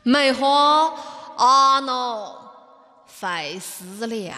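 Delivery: slow recital in Kunming dialect. Shown as a demonstration. Co-host reciting verse at the host's request, Kunming dialect. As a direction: recite it drawn out with a Kunming accent. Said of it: "用昆明话".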